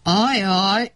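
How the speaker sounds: lightly acted bear groan. A narrator's reading. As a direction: moan it in dismay. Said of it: "nyögött fel Medve Medárd".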